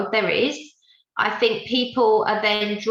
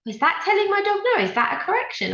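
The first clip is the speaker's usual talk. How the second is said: much higher, fast and puzzled; a client's disbelieving question, pitched way up; imitating a baffled client; 11 semitones higher, faster than usual. Trainer quoting a confused dog owner, high and quick.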